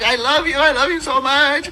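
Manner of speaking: high, gushing mock declaration; imitating emotional woman. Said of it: "I'm over here emotional. She's like".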